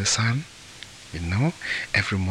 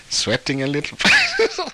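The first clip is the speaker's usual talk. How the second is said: amused, higher and louder, cracking up; breaking into laughter; 7 semitones higher, 5 dB louder than usual. Real, not acted. Storyteller tickled by the sweating mother-in-law, laughing. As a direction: laugh through the end of the phrase.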